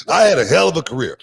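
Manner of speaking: loud, high-pitched bragging about a career; real indignant boast, pitched high; emphatic boast. Real, not acted. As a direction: proclaim it proudly, voice jumping up. Boasting of career while being challenged.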